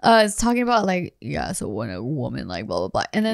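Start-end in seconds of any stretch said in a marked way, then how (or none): 1.2-3.0 much lower, softer hammed-up seductive voice; fake deep sexy voice. Retelling a video of a guy, in a hammed-up deep voice. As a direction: drop way down like a fake smooth guy. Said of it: "a guy talking like that".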